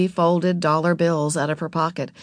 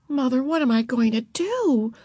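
put-on higher-pitched girl's plaintive demand; 7 semitones higher than usual. A narrator's reading. Beezus demanding help from Mother, high and plaintive. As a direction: demand an answer in a child's voice. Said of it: "Beezus demanded".

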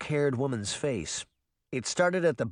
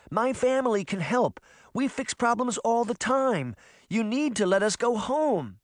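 arguing; 10 semitones higher than usual. A narrator's reading. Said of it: "Sabrina argued".

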